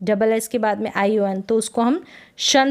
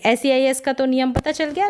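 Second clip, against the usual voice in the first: normal talking voice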